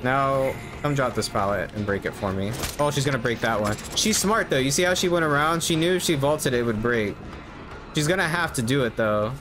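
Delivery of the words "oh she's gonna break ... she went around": usual speaking voice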